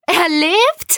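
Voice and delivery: high, rising question of disbelief; astonished question. Acted. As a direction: ask it amazed, pitch shooting up. Jelena reacts to the news.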